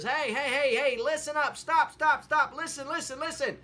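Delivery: put-on old woman hollering for everyone to listen; calling for order. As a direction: yell over the whole town to make them stop. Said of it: "finally gets order restored and says".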